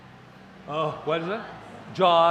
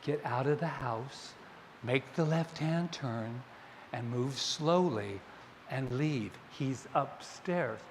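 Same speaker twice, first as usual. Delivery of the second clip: soft, slow guiding voice; a quiet steady voice leading her to safety; calm inner voice; 3 semitones lower, 9 dB quieter than usual. Retold or imitated. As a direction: intuition whispering directions, soft and slow.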